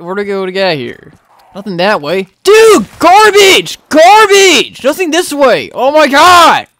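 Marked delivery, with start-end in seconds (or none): 2.4-6.7 high, loud, drawn-out outrage; exasperated yelling. Real, not acted. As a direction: rage at the game's dead end. Dead end in the map.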